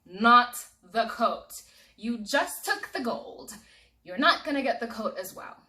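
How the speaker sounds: slow, loud, emphatic objection; outraged protest. Acted. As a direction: refuse hard and slow. Israel protests the robber.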